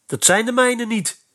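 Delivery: put-on a boy whose hope just sank; very disappointed. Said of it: "zei hij erg teleurgesteld".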